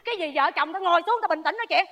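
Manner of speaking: ordinary delivery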